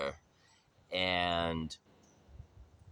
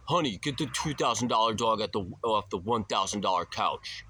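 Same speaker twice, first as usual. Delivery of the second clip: mock yell; 4 semitones higher, 4 dB louder than usual. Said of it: "yell at his wife".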